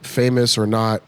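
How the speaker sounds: normal talking voice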